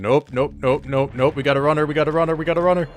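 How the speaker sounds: escalating, urgent chant; mounting panic. Real, not acted. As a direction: babble in rising alarm. Enemy chasing, rising alarm.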